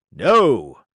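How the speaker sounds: a loud flat no; shouted no. A narrator's reading. Carpenter shouts back at Geppetto, loud. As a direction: bark the denial. Yes-no quarrel.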